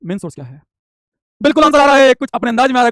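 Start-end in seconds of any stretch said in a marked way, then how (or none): 1.4-2.9 high, loud burst of approval; excited announcement. Real, not acted. Teacher seeing students' answers arrive, excited loud announcement.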